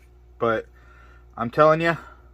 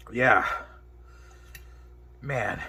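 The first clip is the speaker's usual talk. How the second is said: normal talking voice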